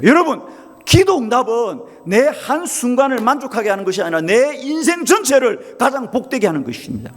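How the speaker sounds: fervent pulpit climax; full-force preaching. Acted. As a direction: build the sermon to its peak.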